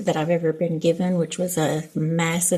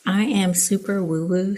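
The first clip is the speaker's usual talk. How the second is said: ordinary talk, nothing marked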